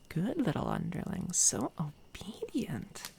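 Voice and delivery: drawn-out, swooping condescending praise; patronizing villain purr, stretching the last words; mocking praise. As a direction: coo it slowly, as if to a pet. Villain praises captive for forced nod.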